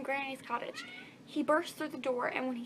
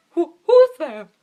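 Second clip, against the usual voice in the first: startled granny; 4 semitones higher, 19 dB louder, slower than usual. Acted.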